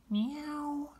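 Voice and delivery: high meowing cat; squeaky cat meow. Acted. Cat meows in picture book.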